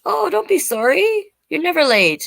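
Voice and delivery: sing-song reassurance to a late viewer; cheery reassurance. Real, not acted. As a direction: brush off the apology happily.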